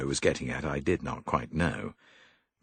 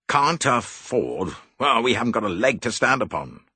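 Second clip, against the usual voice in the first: louder, exasperated repeat; irritated cousin, louder; irritable; 4 semitones higher, 5 dB louder than usual. A narrator's reading. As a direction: snap it back, fed up. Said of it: "said her cousin irritably".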